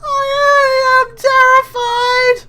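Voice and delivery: high, loud wail of terror; shrieking in fear; terrified cry. Acted. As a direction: squeal it out in high panic. Character screams in fear.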